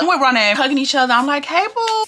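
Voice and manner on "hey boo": high-pitched, drawn-out hello to a friend; high girly-chat greeting; affectionate greeting. Retold or imitated.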